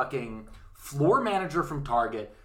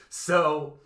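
ordinary talk, nothing marked

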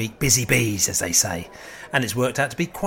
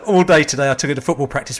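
usual speaking voice

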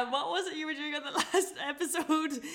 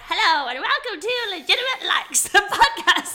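higher, louder host-style intro; mock podcast host; 8 semitones higher, 7 dB louder than usual. Mimicking the rival podcast, hammed-up host intro. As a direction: announce it like a hammed-up radio host. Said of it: "I've started mimicking your podcast".

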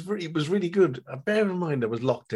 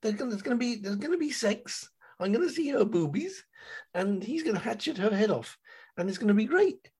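high, eager mock rant; husband ranting to wife, high and excited; excited inner rant; 11 semitones higher than usual. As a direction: gush about the gore and sex high and fast. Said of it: "It's like".

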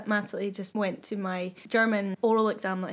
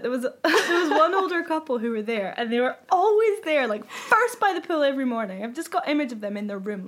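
ordinary talk, nothing marked

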